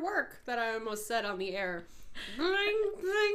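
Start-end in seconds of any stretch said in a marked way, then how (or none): 2.4-3.3 high sing-song ring-ring; phone ring imitation. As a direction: chirp it like a ringing phone.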